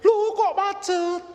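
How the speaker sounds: high, loud, drawn-out dismay; kaget macet. Retold or imitated. Baby stuck halfway out. (seruan heran tinggi dan memanjang)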